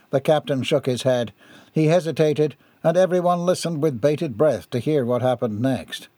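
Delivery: usual speaking voice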